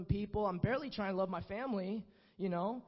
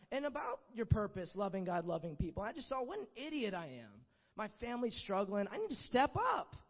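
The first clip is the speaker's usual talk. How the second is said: normal talking voice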